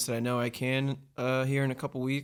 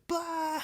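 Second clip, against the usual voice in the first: silly mock blurt. Mock first reaction in car.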